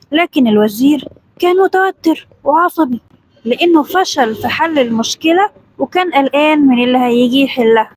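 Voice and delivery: usual speaking voice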